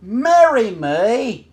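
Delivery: loud, high, drawn-out outraged question; shocked disbelief. Acted. May learning the boys want to marry her, loudly appalled. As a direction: repeat it back aghast. Princess appalled at marriage news.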